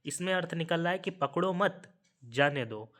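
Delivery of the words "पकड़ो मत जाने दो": पकड़ो मत run together, a break, then जाने दो; pause after मत. Shown as a demonstration. Teacher explaining the second meaning, pause right after मत. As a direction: hold the pause until after मत. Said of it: "यहाँ पे देखिए आपका ठहराव".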